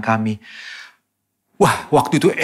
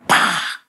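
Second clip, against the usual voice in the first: bottle smash sound; 5 semitones higher than usual. Retold or imitated. Mimics the bottle strike.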